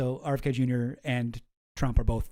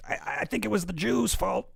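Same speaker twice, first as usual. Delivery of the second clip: a higher, breathy attempt at his voice; higher, half-failed RFK Junior; RFK Junior impression; 6 semitones higher than usual. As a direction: strain to hit the RFK voice. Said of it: "Sometimes I can do pretty good, R F K Junior".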